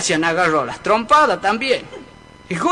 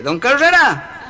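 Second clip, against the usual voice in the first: higher, drawn-out call; 4 semitones higher, slower than usual. Acted. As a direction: slowly call out for someone at the door. Calling at a house door.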